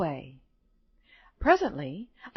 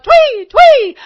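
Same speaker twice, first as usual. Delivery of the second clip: very high, very loud bird alarm; shrieked tweets to sound the alarm; robin calling out; 18 dB louder than usual. A narrator's reading. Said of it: "who called out".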